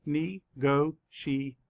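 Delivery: slow, each syllable pulled apart. Shown as a demonstration. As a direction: sound out each syllable slowly. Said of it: "Let's count the syllables".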